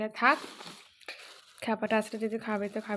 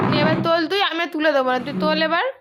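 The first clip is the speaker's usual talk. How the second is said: higher, louder firm scolding; 6 semitones higher, 11 dB louder, faster than usual. Real, not acted. Mother telling her child to pick it up himself, scolding.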